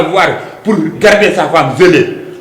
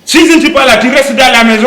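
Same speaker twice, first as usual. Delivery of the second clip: raised, higher scolding voice; sharp telling-off, pitched up; angry scolding; 7 semitones higher, 4 dB louder than usual. Acted. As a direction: snap at her from up high. Husband scolds wife in quarrel.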